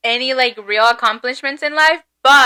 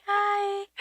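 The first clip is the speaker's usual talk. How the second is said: a tiny, much softer hi; quiet greeting; 7 semitones higher, 13 dB quieter than usual. Retold or imitated. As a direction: say it as shyly as a whisper. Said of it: "And she's very quiet, like".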